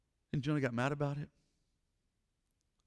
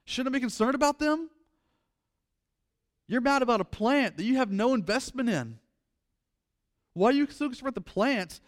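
loud, high impassioned preaching; preaching at full force; 8 semitones higher, 7 dB louder than usual. Acted. Preacher pleading with the congregation, loud and high. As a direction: pour it out from the pulpit, loud and high.

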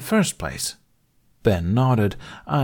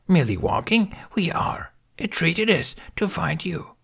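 high, whispery ancient master; gentle Yoda voice; 8 semitones higher than usual. A narrator's reading. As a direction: hush it like a tiny wise elder. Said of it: "Yoda smiled".

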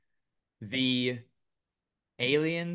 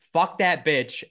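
loud, raised mock yell; retold yell; 5 semitones higher, 9 dB louder, slower than usual. Host retelling the defendant's courtroom outburst, mock yell. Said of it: "he yells".

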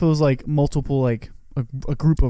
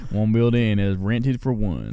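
slow, low cowboy drawl; cowboy accent; 4 semitones lower, slower than usual. Acted. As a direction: answer as a slow-talking cowboy. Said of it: "like, cowboy accent".

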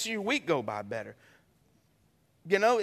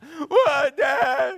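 a higher, louder hammed-up wail of a hurt kid; crying child's shout; 5 semitones higher, 6 dB louder than usual. Hurt child wailing to Dad, mock crying shout. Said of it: "they're crying".

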